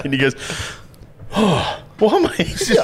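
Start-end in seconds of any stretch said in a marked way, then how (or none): 1.3-2.8 high, slow, confused hungover mumble; a mate who has no idea where he is; dazed mate. Retold or imitated.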